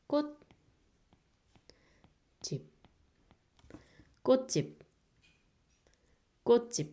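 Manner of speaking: teacher models "꽃집" while writing; Korean word kkotjip. Shown as a demonstration. Teacher repeating "꽃집" for students. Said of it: "To write the letter, the word".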